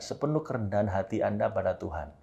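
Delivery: ordinary talk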